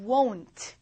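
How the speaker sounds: "Won't" with the vowel gliding from o to oo; two-part vowel won't. Shown as a demonstration. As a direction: round "Won't" through two vowel sounds, woh-oont. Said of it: "얘는요, 두 번 모입니다".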